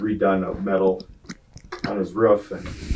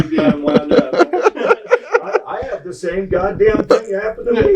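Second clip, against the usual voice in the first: high laughing imitation; laughing impression; 10 semitones higher, 4 dB louder than usual. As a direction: laugh through it.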